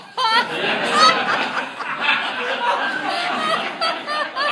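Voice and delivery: very high, loud laughing fit; real laughter; shrieking laughter. Real, not acted. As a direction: crack up completely. Laughs at father's answer.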